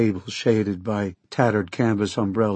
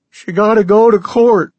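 loud, much higher twangy Okie yell; shouted Okie accent; 11 semitones higher, 9 dB louder than usual. Acted. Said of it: "shouted at me in that out-of-tune banjo Okie accent".

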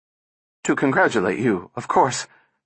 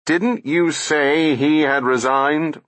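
roaring; 6 semitones higher, slower than usual. A narrator's reading. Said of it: "roared Bogart".

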